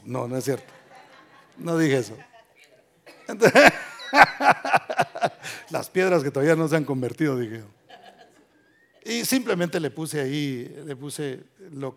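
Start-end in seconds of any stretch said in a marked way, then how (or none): 3.3-7.4 laughing. Retold or imitated. Laughing retold joke reply.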